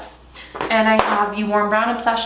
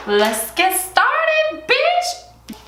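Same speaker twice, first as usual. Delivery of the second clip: high, drawn-out sing-out, rising to a squeal; hyped-up kickoff; much slower than usual. Real, not acted. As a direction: stretch it out and squeal the last word. Hyped-up cue to begin.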